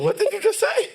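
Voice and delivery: very high, loud, laughing disbelief; amused disbelief. Real, not acted. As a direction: squeal the question in shocked amusement. Incredulous reaction to odd phrase.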